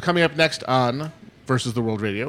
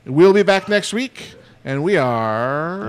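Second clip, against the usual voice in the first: announcer sign-off; 4 semitones higher, 5 dB louder, slower than usual. Acted. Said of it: "sign-off announcer flourish".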